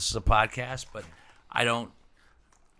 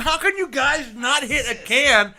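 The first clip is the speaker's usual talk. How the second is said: loud, high-pitched incredulous rant; exasperated yelling; 12 semitones higher, 9 dB louder than usual. Real, not acted. Host scolding friends for missing the can, exasperated yelling. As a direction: berate your friends at full voice.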